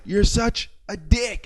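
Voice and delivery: high, slow, drawn-out jeer; incredulous. Retold or imitated. Kept on at the driver as he left, incredulous. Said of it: "I was so shocked that I just went".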